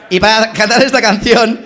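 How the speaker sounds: ordinary talk, nothing marked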